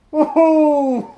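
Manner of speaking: loud, high whooping entrance with a laugh; gleeful whoop. Pretend. Whooping loudly, bursting back in on his cohost.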